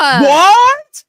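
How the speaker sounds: high, loud disbelieving "What?"; host shouting in disbelief at her own guess; stunned shout. Real, not acted. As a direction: shout it in real amazement. Reacting to correct guess.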